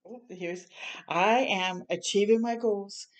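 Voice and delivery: ordinary talk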